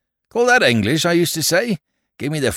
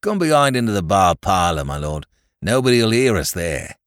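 ordinary delivery

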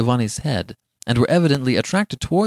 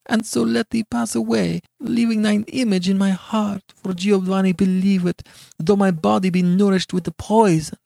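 high-pitched, impassioned heroine; pleading Beatrice; 9 semitones higher than usual. A narrator's reading. Beatrice begging Giovanni to believe her, desperate high plea. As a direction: plead your love and innocence, high and urgent.

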